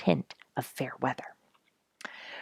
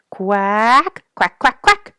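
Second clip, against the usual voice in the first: high, loud duck calls sent across the pond; loud calling quacks; 7 semitones higher, 10 dB louder, much slower than usual. A narrator's reading. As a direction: call out like a duck hailing her mate. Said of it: "she called in a loud voice".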